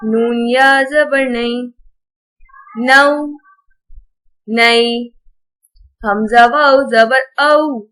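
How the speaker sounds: gentle, even Qaida recital, no stretching or jerking; patient teacher's drill reading. Acted.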